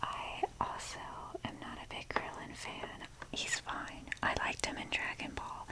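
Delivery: ordinary delivery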